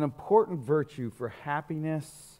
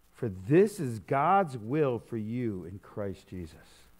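solemn scripture reading; 7 semitones lower, slower than usual. Acted. Reads scripture slowly, lower.

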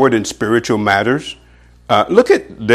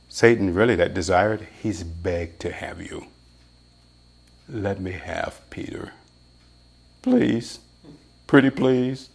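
much softer, wheedling plea; mock begging; 3 semitones lower, 7 dB quieter than usual. Satan begging the Lord for Peter, soft mock pleading. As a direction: beg sweetly for what you want.

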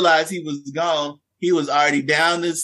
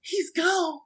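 put-on high and hushed child voice; lightly voiced student, softer; a hint of a worried little girl; girl's alarmed report; 12 semitones higher, 7 dB quieter than usual. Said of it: "They said".

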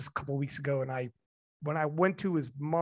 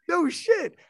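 surprised exclamation; 12 semitones higher than usual. Retold or imitated. Crowd reacts to his arrival.

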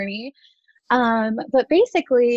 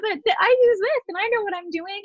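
high-pitched, faltering mock defense; squeaky retold protest; flustered defensiveness; 12 semitones higher than usual. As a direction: imitate yourself stammering a comeback, voice way up. Retold stammering reply to jerk.

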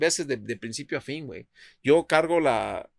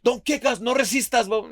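much higher and louder commands; shouted police orders; 9 semitones higher, 3 dB louder than usual. Retold or imitated. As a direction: bark orders at a handcuffed man. Police officers barking orders. (policías gritando órdenes, mucho más fuerte y agudo)

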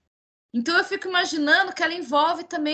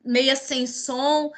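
usual speaking voice

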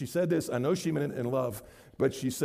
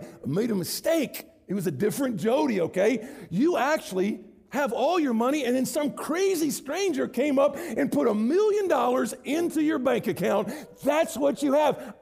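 excited good news; 9 semitones higher, 3 dB louder than usual. Acted. Preacher telling Jody his money is back, excited and loud. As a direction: announce the windfall with delight.

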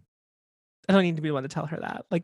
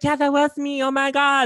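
excited; 6 semitones higher, 4 dB louder than usual. Retold or imitated. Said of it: "I was like".

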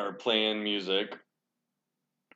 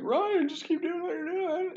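high, put-on teasing voice egging him on; squeaky voice of the roommate goading Ryan; Josh's high teasing voice urging the bleeding roommate on; mocking friend voice. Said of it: "he's laughing 'cause he always loved antagonizing".

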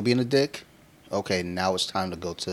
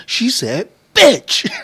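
very high, loud imitation of a gleeful kid; mock-childish thrilled squeal; excited tattling; 12 dB louder, much slower than usual. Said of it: "see the excitement in her heart".

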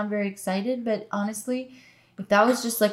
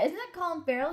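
high, softer star-spotting question; mock distraction; 8 semitones higher, 5 dB quieter than usual. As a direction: pitch it up, as if suddenly noticing someone. Acts out the distraction line.

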